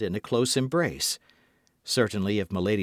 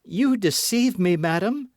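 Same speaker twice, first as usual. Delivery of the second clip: accusing; 9 semitones higher, 4 dB louder than usual. A narrator's reading. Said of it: "said the novice".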